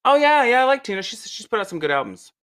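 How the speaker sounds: high, offhand brush-off; dismissive. Retold or imitated. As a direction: toss it off breezily. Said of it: "she's like".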